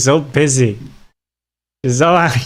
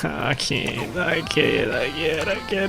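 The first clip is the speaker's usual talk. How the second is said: softer, laughing through mock pain; mock anguish; 3 semitones higher, 7 dB quieter, slower than usual. Real, not acted. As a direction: groan it out as a playful lament. Mock pain after losing resources.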